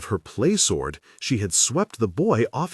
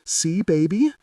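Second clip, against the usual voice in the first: put-on small, high child asking; a two-year-old's tiny question; lightly voiced little boy; tiny toddler voice; 9 semitones higher than usual. A narrator's reading. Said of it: "he asked in his tiny voice".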